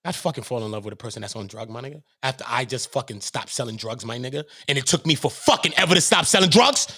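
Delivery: ordinary talk, nothing marked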